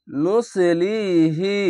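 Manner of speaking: নুসলিহি very slow, the লি drawn out one alif long; stretched recitation. Shown as a demonstration. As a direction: chant নুসলিহি slowly with a long লি. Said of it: "এক আলিফ টেনে পড়বেন". (ধীরে টেনে তেলাওয়াত)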